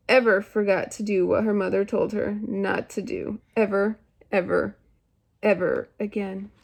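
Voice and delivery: expressive, measured story narration; lively read-aloud with dramatic pauses; storytelling to children. A narrator's reading. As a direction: read a fairy tale to young children, stretching key words. Reads Goldilocks aloud to children.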